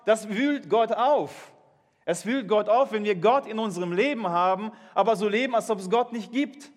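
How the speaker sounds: ordinary talk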